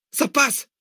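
high joyful shout. Acted. Zach breaking through the dirt, shouting. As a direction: cry out the breakthrough. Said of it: "cria Zach".

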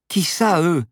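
high, loud, anxious question; worried passenger raising her voice. A narrator's reading. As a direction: demand an answer in alarm, pitch way up. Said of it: "s'inquiéta Paloma".

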